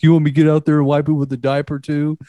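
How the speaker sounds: mocking roommate over his new truck, fast and high; mocking taunt. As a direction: tease him like he's a baby about his truck. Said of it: "just kinda giving him shit".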